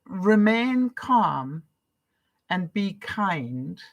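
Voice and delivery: slow, deliberate repeat of the rule; calm, measured recitation; slow mantra. Shown as a demonstration. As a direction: let the words sink in slowly. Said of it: "When you hear that".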